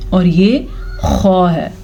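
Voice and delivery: खौ said slowly with a rough, scraped kh from the back of the throat; letter pronunciation. Shown as a demonstration. Teacher contrasting khaa with ha for students, slow demo. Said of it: "हा के मखरज का ध्यान रखना है".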